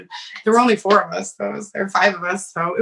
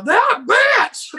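shouted high and loud; crowd shout; 11 semitones higher, 4 dB louder than usual. Retold or imitated. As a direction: yell it like a rowdy bar crowd. Bar crowd yelling in unison.

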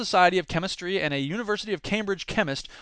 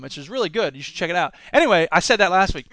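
ordinary talk, nothing marked

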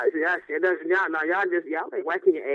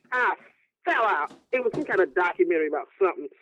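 ordinary talk

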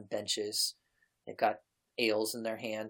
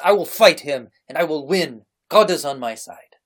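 loud, fierce declaration; defiant shout; 4 semitones higher, 15 dB louder than usual. Acted. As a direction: shout it out, sure of winning. Defiant son cuts in.

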